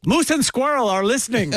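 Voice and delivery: high, hammy cartoon Russian spy; Russian accent. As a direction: ham up a Cold War villain in a Russian accent. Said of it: "We're all doing bad Russian accent".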